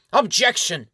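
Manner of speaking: sharp, high shout of protest; shouted objection. Acted. High Elf shouting his objection across the council. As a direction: cut in loudly, high and indignant.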